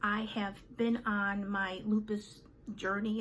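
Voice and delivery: ordinary talk, nothing marked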